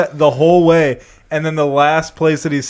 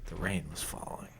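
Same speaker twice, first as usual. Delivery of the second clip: low, soft noir narration; brooding voiceover, low and soft; 9 semitones lower, 23 dB quieter than usual. Pretend. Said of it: "they did the one episode".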